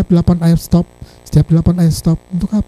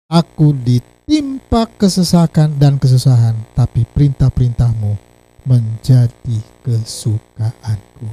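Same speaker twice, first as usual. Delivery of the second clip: scripture reading; 5 semitones lower, slower than usual. Acted. Reads psalm verse aloud.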